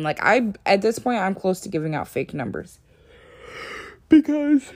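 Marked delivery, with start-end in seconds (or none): 4.1-4.7 word swallowed by a high yawn; talking through a yawn. Real, not acted. Vlogger caught by a yawn mid-sentence, yawning as she speaks. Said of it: "I'm yawning".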